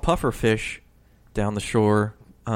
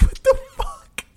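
breathy, slow whispered what the fuck through laughter; hushed disbelief while cracking up; slowed whispered reaction, half laughing; stunned laughing whisper; 4 dB louder, slower than usual. Retold or imitated. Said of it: "I'm like".

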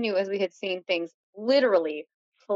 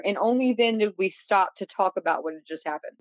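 usual speaking voice

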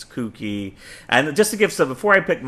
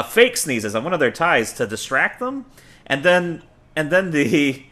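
ordinary talk

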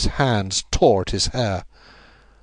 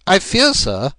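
higher, composed detective voice cutting in; Holmes, cool and firm; 10 semitones higher, 5 dB louder, slower than usual. A narrator's reading. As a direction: interrupt politely but firmly. Narrator voicing Holmes.

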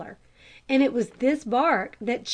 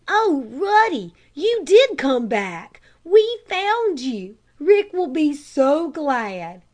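high, loud, slow cries of joy; overjoyed, welcome the dog home; joyful cry; 3 semitones higher, 6 dB louder, slower than usual. A narrator's reading. Tom greeting the returned dog, loud joyful cry. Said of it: "cried Tom".

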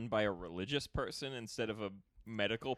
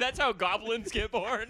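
high, slow spooky voice; 11 semitones higher, 8 dB louder than usual. Acted. Joking spooky goblin line.

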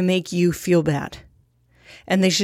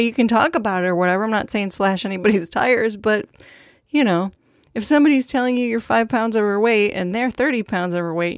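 usual speaking voice

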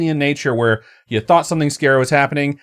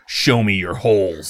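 slow, low creepy purr; lightly voiced phone creep; a slow mock-menacing come-on; 6 semitones lower, slower than usual. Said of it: "I've never once said".

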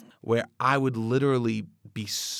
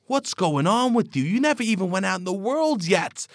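higher, mildly raised mock yell; 8 semitones higher than usual. Other socks yelling at the dripping sock, mild mock yell. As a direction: scold the wet sock like a jeering crowd. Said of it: "And socks are yelling".

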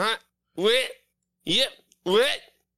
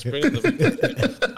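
higher, laughing through words; 7 semitones higher, 4 dB louder than usual. Real, not acted. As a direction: repeat it while cracking up. Laughing echo.

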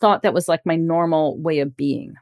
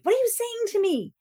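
mock frantic demand; 14 semitones higher, 3 dB quieter than usual. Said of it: "you're just losing it".

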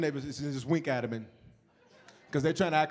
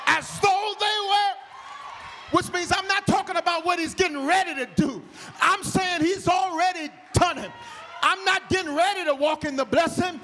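full-force preaching; 12 semitones higher, 5 dB louder than usual. Acted. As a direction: preach it out to the back row.

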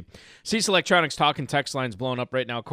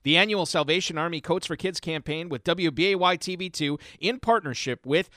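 ad read; 4 semitones higher than usual. Acted. Reads charity ad on air.

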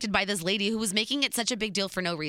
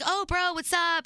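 higher-pitched, upbeat imitated greeting; friendly bro greeting; 9 semitones higher than usual. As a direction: greet a buddy you just spotted. Acted friendly greeting from acquaintance.

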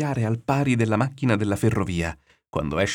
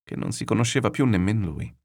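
ordinary delivery, nothing marked

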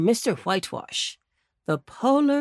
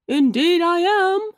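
put-on slow, higher, louder old gentleman; old rabbit answering; 9 semitones higher, 5 dB louder than usual. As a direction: answer kindly and slowly. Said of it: "answered Mister Longears".

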